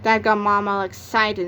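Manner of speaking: usual speaking voice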